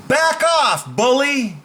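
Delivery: high, forceful call-out; forceful warning. Shown as a demonstration. Coach demos confronting a bully, high forceful warning.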